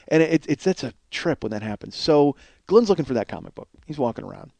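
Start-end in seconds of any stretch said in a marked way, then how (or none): none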